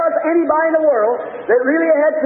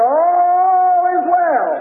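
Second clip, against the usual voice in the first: high, sustained preacher's cry; acted cry of steadfast faith; triumphant declaration; 5 semitones higher, much slower than usual. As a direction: stretch it out long on one high note. Same answer repeated over turmoil.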